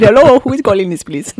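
put-on light Indian accent on the phone. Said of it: "I did an Indian accent".

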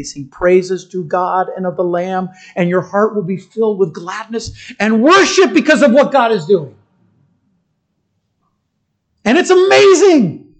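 building to a loud, high, near-tearful cry; climactic preaching. Acted. Pastor crying out to the congregation at the sermon's peak. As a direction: swell up until the voice breaks on amazing.

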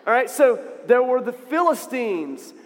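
ordinary delivery

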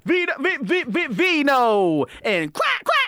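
stuttering DJ hype, climbing to a high shriek; loud remix-style stutter announcer; mock radio promo. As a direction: hype it like a radio jingle, words repeating.

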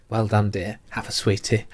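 put-on low, soft old lady voice; kindly old lady. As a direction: pat their hand and coo it lowly. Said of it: "she pats your hand and goes".

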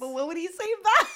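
high, incredulous question breaking into laughter; laughing question. Real, not acted. Teasing her friend about the boyfriend's baby voice, laughing. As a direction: press for an answer through laughs.

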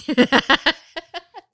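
loud laugh. Real, not acted. Laughs at own mix-up.

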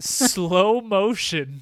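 slow-motion delivery, words drawn long. Acted. Co-host narrating the show's big shot, drawn-out slow. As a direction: stretch the words out slowly.

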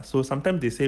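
ordinary delivery

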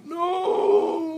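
very high, loud anguished cry; buried man as dirt falls, hysterical scream. Acted. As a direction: let it tear out of you. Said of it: "Frank hysterically".

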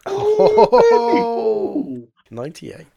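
squealing with delight at the roll; thrilled high yelp over the dice; excited. Real, not acted. Rolls ninety-eight, delighted.

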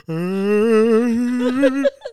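high drawn-out fake wail; hammed-up comic sobbing; mock crying. Imitating crying like a movie bluesman, mock wail. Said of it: "crying like [name]".

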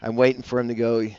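ordinary delivery

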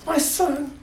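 high, loud with gasps between; overwhelmed with delight; breathless joy. Acted. God announcing his son, breathless high joy.

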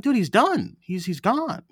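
high, emphatic telling him he's gone; emphatic reply. Retold or imitated. Breaking the news to her boss, high and emphatic. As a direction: set him straight, eagerly. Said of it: "I'm like".

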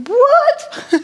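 mock American outrage; incredulous what. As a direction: squeal it in disbelief.

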